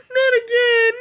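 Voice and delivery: very loud, high-pitched outcry; exasperated cry. Real, not acted. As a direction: yell it out in high dismay. Cries out at recurring mishap.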